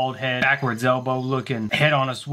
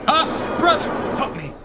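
pleading; 13 semitones higher than usual. Pretend. Attacked, pleads for help.

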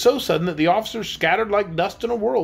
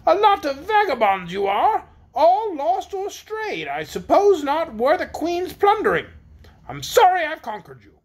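high-pitched, haughty disdain; scornful queen; 11 semitones higher than usual. A narrator's reading. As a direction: look down on them in a high queenly voice. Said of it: "said Anne scornfully".

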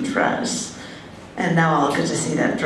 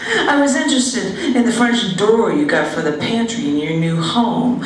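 ordinary talk

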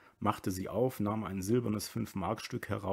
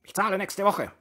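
high, strained raised voice; shouted protest; 11 semitones higher, 7 dB louder than usual. A narrator's reading. Brüller promises the landlord payment, shouting. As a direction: shout back to get credit.

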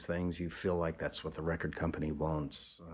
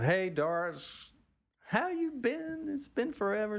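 Charlie greeting. Retold or imitated. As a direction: stretch it out like greeting an old friend. Charlie greets old friend Doris.

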